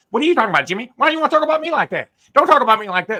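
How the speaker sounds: high, rapid mock-indignant coach; Jimbo Fisher. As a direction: imitate a coach griping at full tilt. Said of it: "It is a low key Jimbo Fisher".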